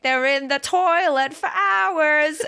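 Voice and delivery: put-on high, slow, sassy girl singer; hammed-up musical girl complaining; mock girl singer. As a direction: camp up a whiny showtune line. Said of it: "one of them was like".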